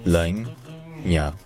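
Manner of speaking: Emilian Bologna split slowly as bu, LA, nya; slow "bu... LAY... nya" for Bologna; syllable by syllable. Shown as a demonstration. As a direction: sound out Bologna in Emilian, piece by piece. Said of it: "en émilien, c'est plutôt".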